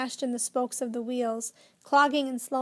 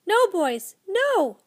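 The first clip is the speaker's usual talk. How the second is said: high, loud warning yell; 7 semitones higher, 7 dB louder, slower than usual. A narrator's reading. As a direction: shout it at the top of your voice. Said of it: "he yelled at the top of his voice".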